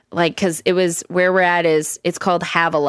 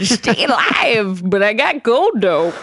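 put-on old prospector; 4 semitones higher, slower than usual. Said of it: "old prospector".

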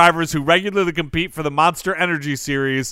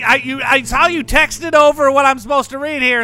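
Taylor Gray; 8 semitones higher, 3 dB louder than usual. Pretend. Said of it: "Oh, hey, Taylor".

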